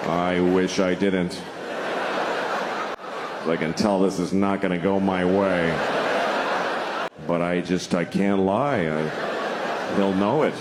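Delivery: deep-down resigned mumbling to himself; reluctant admission. Acted. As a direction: confess it against your will. The man asked, reluctantly admitting.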